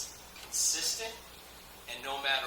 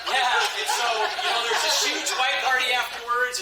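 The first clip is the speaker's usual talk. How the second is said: laughing; 7 semitones higher, 8 dB louder than usual. Real, not acted. Big laugh mid-story.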